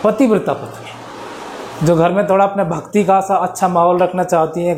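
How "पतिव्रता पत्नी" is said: normal talking voice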